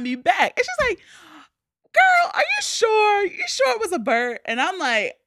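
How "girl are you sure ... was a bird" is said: imitating an incredulous mom; disbelieving mom.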